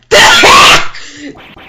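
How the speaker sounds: big startled laugh; hearty guffaw; loud burst of laughter. Acted. Reacts in character.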